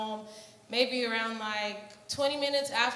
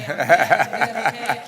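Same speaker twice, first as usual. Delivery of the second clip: brother's reassurance; 8 semitones lower, 14 dB louder than usual. Retold or imitated. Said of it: "and he was like".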